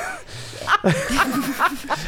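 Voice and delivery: high delighted laugh. Real, not acted. Laughing along with the co-host, high laugh. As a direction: let a high laugh ring out. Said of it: "Hat sie nicht die schönste Lache?".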